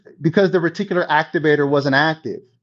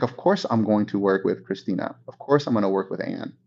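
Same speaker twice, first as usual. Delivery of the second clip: softer, lower, matter-of-fact client; eager client; 6 semitones lower, 6 dB quieter than usual. Retold or imitated. Client agreeing to hire the agent, softly certain. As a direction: say it like it's obvious. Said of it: "They're gonna be like".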